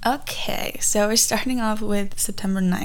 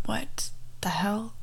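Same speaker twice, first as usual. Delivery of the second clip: shocked whisper; 8 dB quieter, slower than usual. Retold or imitated. Shocked at finding the pin.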